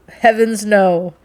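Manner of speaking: loud, startled exclamation; emphatic denial. A narrator's reading. Captain answers whether he'd prefer another woman, emphatic denial. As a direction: reject the idea outright. Captain rejects Rachel's suggestion.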